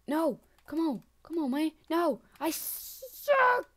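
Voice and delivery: high, loud, building to a screech; exasperated yelling. Real, not acted. Player keeps dying, exasperated yelling.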